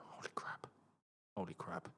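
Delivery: whispered disbelief under the breath; hushed, barely voiced shock; stunned whisper. Real, not acted. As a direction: mutter it very softly, stunned.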